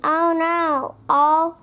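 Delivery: plain reading voice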